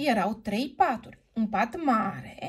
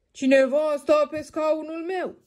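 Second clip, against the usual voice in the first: lightly hoarse father bear, a bit louder; a hint of a gruff big bear; hoarse papa bear; 5 semitones higher, 4 dB louder than usual. Papa bear sees someone sat in his chair, lightly hoarse. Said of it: "spuse tatăl cu vocea răgușită".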